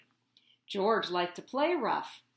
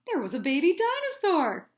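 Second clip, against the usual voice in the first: higher, louder and fast reveal; big reveal; 6 semitones higher, 7 dB louder, faster than usual. A narrator's reading. Narrator revealing the hatched dinosaur, excited. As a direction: announce the surprise with a flourish.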